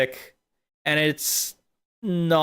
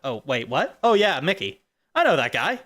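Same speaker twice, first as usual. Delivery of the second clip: ordinary delivery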